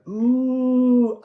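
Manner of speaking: high, loud ooh of delight; a sudden thrilled ooh. Real, not acted. Reader delighted as the Pluto card comes out, thrilled ooh.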